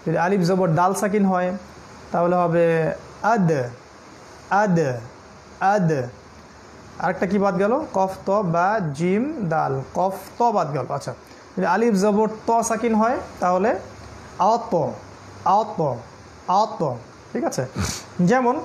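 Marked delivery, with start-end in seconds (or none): 3.3-5.9 আদ with the "d" bounced, "ad-e"; echoing double sound. Shown as a demonstration. Teacher drills dal sakin, bouncing end on আদ. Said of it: "double sound করে প্রতিধ্বনিমূলক শব্দ করে". 14.5-16.8 আত with a heavy "t" bounced, "at-o"; echoing double sound. Shown as a demonstration. Teacher drills the letter to, bouncing heavy end on আত. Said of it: "double sound করে প্রতিধ্বনিমূলক শব্দ করে".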